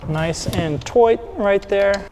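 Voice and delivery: put-on slow, hammy Irish accent, toit for tight; a bad stage-Irish accent, drawn out. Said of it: "trying to do a terrible Irish accent".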